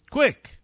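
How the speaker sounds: Quick, sharply high and drawn out; punched single word. Acted. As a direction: hammer home the one word Quick. Echoes his point, punching it.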